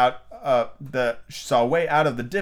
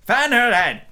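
high, loud cry; anxious interruption; 12 semitones higher, 7 dB louder, slower than usual. A narrator's reading. Red Queen interrupting over feverish Alice, anxious. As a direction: cut in loudly with alarm. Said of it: "the Red Queen anxiously interrupted".

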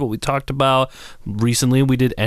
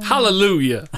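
mock preacher hallelujah; 9 semitones higher than usual. Mock church response.

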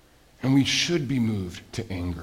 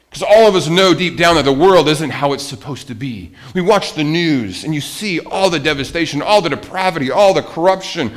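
higher and louder list, each all hit hard; preaching; 5 semitones higher, 6 dB louder than usual. Acted. Preacher to congregation, listing the world's devastation with growing force. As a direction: hammer each item of the list.

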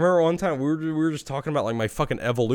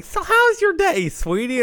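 sweet mom voice; 4 dB louder than usual. Retold or imitated.

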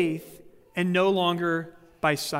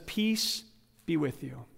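gentle greeting; 3 semitones lower, 6 dB quieter than usual. Retold or imitated. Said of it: "He walks in and he says this".

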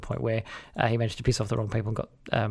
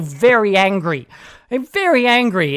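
VERY ANGRY pushed high and loud; emphatic repetition; 6 semitones higher, 8 dB louder, slower than usual. Real, not acted. Host recalling how Mediscare angered Malcolm Turnbull, loud and emphatic. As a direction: punch "very angry", loud and raised. Relishing the prime minister's anger.

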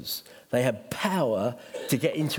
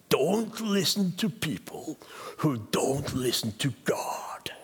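slow hammed-up Welsh accent, fading to a whisper; bad Welsh accent; slower than usual. Welsh preacher friend advising the pastor, bad Welsh accent. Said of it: "my very bad Welsh accent".

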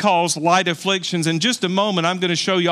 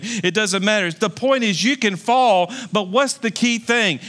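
fiery preaching; 4 semitones higher than usual. Acted. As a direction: lift the congregation with rising fervour. Preaching rises to full force.

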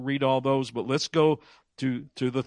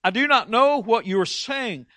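higher, louder heated denial; defensive denial; 7 semitones higher, 4 dB louder than usual. Retold or imitated. Preacher voicing Peter's denial to a questioner, heated and higher. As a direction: Peter snaps back denying he knows Jesus. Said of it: "But Peter said".

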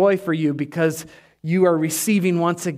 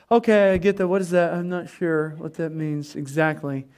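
unsure disciples; 3 semitones lower than usual. Acted.

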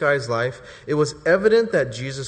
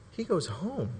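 softly dropped answer; hushed reveal; 3 semitones higher, 8 dB quieter than usual. Real, not acted. Preacher answering his own question, hushed. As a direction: let it land quietly.

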